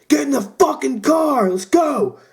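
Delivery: very high-pitched, strained mock scream; imitated urgent yelling. Friend ordering everyone into the car, high frantic yell. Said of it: "he's pointing and he's yelling".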